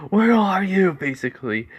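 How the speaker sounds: high, loud taunting call; villain calling out. Acted. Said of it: "These villains are going like".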